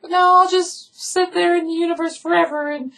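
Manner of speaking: very high falsetto held on one note; hammed-up refusal in a squeaky girl voice; mock lovelorn girl. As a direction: parody a stubborn, melodramatic lover. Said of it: "she's like".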